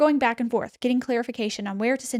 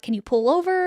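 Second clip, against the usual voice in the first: higher, lightly voiced dispatcher question; imitated operator; 7 semitones higher than usual. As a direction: ask it as the operator, pitched up. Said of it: "The operator was like".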